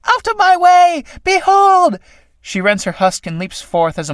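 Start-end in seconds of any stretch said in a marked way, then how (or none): 0.1-2.0 high, loud shriek bursting out; triumphant shriek of a newborn moth. Acted. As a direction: scream it as she tears free.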